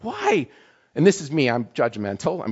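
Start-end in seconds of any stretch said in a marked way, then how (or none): 0.0-0.5 high-pitched exasperated why; indignant, pitched way up; incredulous. Retold or imitated. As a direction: squeal it in disbelief. Acting out his own judgmental outrage.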